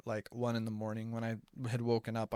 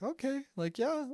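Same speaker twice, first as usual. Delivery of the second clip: imitating the girl, pitched much higher and hesitant; a shy, embarrassed okay from the nineteen-year-old; awkward girl; 13 semitones higher than usual. Girl responds to doctor's matchmaking.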